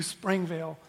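ordinary delivery

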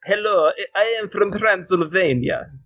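put-on slow, high vampire girl accent; slow put-on Transylvanian accent. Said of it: "a Transylvanian vampire girl voice".

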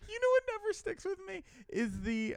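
Joe voice. Acted. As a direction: slip into your buddy Joe's voice. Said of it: "why did I just turn into Joe?".

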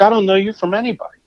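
fast, high, fed-up retort; exasperated retort. Retold or imitated. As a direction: rattle it off in disbelief. Confronting the interrupting man.

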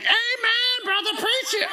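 high-pitched congregation shout-out; comic falsetto amen from the front row; cheering wife. Retold or imitated. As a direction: imitate an over-eager wife cheering on the preacher.

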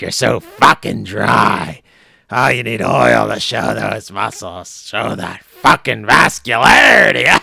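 loud, gravelly-free wrestling promo bellow, hammed-up; Vince McMahon impression. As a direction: yell it like a wrestling boss hyping his guy. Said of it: "he asked for a Vince McMahon one more time".

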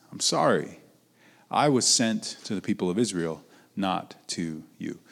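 soft refusal. Acted. Jesus turning the Canaanite woman away, quiet refusal. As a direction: let it down softly, with pauses before the final words.

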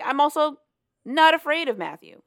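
defiant. Retold or imitated. Wife telling her husband she isn't scared, defiant. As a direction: punch it out as her bold declaration.

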